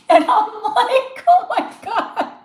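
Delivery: high, loud, drawn-out laughing words; laughing disbelief. Real, not acted. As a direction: crack up while saying it. Laughing retelling of shocked reaction.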